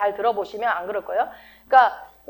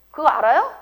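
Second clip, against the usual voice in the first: usual speaking voice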